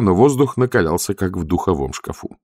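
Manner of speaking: usual speaking voice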